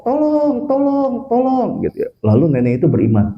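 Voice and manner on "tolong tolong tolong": high, drawn-out mock cry for help; calling for help. Stranded grandmother to anyone passing, mock cry for help. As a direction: imitate a stranded granny calling out. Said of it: "nenek itu minta tolong".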